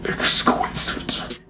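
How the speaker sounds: slow, forceful stage whisper; savouring whisper. Pretend. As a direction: whisper it slowly with exaggerated relish. Savouring praise of the psychopaths.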